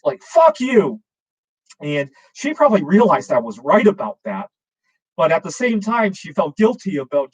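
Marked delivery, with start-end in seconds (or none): 0.2-1.0 high, loud mock outburst; sharply higher retold shout; raised angry voice. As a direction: imitate an upset mother yelling. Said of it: "really raised her voice".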